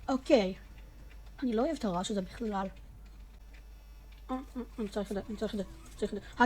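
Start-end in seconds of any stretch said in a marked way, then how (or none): none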